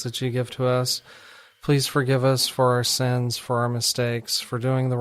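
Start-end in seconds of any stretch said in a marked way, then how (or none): none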